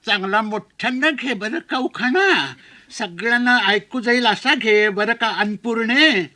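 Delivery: slow, drawn-out put-on aunt voice; exaggerated slow village aunt; fussing old auntie. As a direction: coax the bride in a lilting auntie voice. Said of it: "त्यातलीच एक गुजामावशी तिथे आली".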